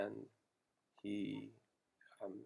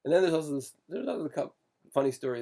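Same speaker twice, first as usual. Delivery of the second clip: excited; 6 semitones higher, 12 dB louder than usual. Real, not acted. Animated start of new story.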